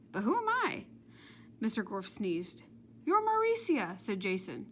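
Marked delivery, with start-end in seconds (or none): 0.1-0.9 very high puzzled question; high borrowed voice. A narrator's reading. Jenny confused by the voice she got back, very high. 3.1-4.0 Scottish gentleman. A narrator's reading. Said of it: "sounded like a gentleman from Scotland".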